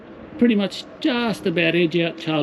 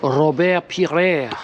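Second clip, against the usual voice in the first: slow, careful French accent on the name Robert Pires; much slower than usual. Shown as a demonstration. Fan at the Arsenal wall trying the French name, slow French accent. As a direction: draw out Pires the French way, savouring it. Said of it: "in the right French accent".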